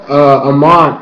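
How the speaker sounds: ordinary delivery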